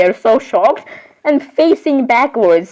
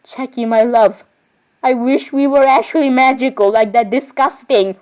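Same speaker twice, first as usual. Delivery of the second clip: normal talking voice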